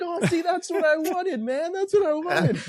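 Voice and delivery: very high, squealed mock triumph; high-pitched, playful exclaiming; retold excited vindication. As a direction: act out finally being recognised. Acted payoff of recognition story.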